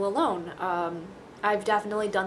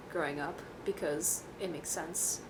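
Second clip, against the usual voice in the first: ordinary talk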